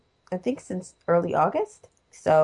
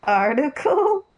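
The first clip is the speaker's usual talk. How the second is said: gentle teasing; 5 semitones higher, 5 dB louder, slower than usual. Acted. Teasing the slip of tongue.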